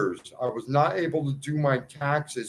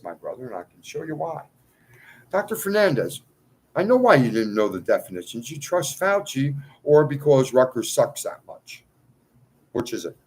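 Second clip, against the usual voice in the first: ordinary talk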